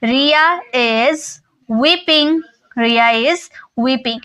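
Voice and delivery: slow, deliberate model sentence; teacher dictating a sentence slowly; slow dictation. Shown as a demonstration. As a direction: lay each word out slowly for the class. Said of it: "तो हम बोलेंगे".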